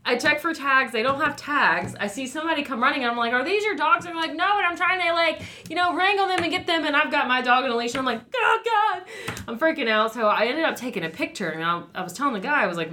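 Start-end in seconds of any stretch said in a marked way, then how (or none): 3.3-4.0 high, urgent retold question; retold frantic question. Dog walker asking a passer-by, frantic retelling. 4.4-6.8 high, hurried imitation of a stranger; reply in a flustered, raised voice; imitated flustered stranger. Passer-by trying to wrangle loose dogs, flustered imitation. 8.3-9.0 high, mock-panicked cry; retold panic. Dog walker with leashed dog among strays, retold panic.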